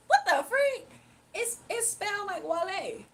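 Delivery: high, loud what the freak; surprised outburst. Real, not acted. Streamer reacting to chat's spelling claim, startled. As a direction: blurt it out in disbelief.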